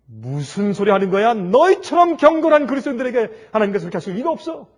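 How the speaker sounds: loud, high refusal to believe; indignant disbelief. Acted. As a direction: push back loudly, shocked. Mother refusing the news.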